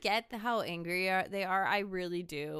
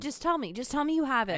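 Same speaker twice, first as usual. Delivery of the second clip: insistent, higher-pitched mock plea; retold pleading; 6 semitones higher, 4 dB louder, faster than usual. Retelling her begging for the map, pleading. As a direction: press them for an answer, pitched up.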